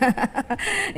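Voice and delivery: short soft laugh. Real, not acted. Preacher joking with congregation about absent pastor, soft laugh. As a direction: chuckle softly at your own joke.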